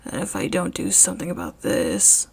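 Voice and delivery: quiet steely resolve; low determined man voice. Acted. Eraserhead, resolved.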